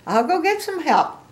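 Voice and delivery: high, loud, eager pig voice; Piggy. Acted. Piggy decides to find someone, high and eager. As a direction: call it out brightly and high.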